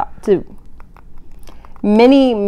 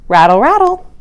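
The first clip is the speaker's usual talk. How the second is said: louder, higher rattle; rattling snake tail; 5 semitones higher, 5 dB louder than usual. Acted. Voicing a rattlesnake's warning rattle, louder and higher.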